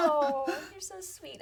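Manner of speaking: high, slow, affectionate coo; touched, cooing. Real, not acted. Author comforting an emotional fan, touched and cooing.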